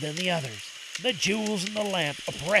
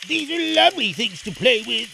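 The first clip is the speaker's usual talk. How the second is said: lightly voiced boy, pitched up and louder; pleased Aladdin, higher than the narrator; delighted boy Aladdin; 4 semitones higher, 5 dB louder than usual. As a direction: boyish delight, raised voice.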